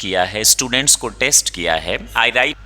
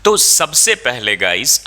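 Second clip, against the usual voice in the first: ordinary talk, nothing marked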